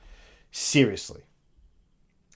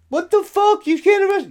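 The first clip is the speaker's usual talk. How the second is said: إحتجاج مستنكر بسخرية; 12 semitones higher than usual. Retold or imitated. Mocking an indignant complainer.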